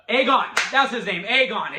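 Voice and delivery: loud "Aegon!" as it finally comes; triumphant recall. Real, not acted. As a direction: cry out the name you remembered. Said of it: "It took me a minute, but I finally got there".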